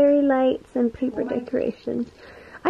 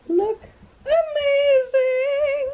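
excited; much slower than usual. Real, not acted. Excited pizza reveal.

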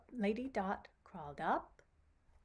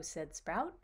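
usual speaking voice

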